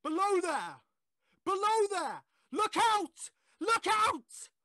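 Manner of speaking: high, loud shout of alarm; warning shout. Acted. Voice on the line warning the man below, high loud shout.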